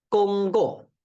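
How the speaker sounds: gonggo said with high Mandarin tones; Mandarin word. Shown as a demonstration. Teacher introduces gonggo in Mandarin tones. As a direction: model gonggo for the class.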